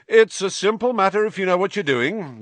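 high, loud, showy proclamation; loud and self-assured. A narrator's reading. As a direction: boast so everyone nearby hears. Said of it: "Lockhart was saying loudly".

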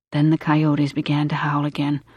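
plain reading voice

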